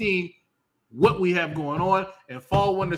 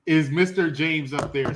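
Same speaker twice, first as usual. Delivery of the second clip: normal talking voice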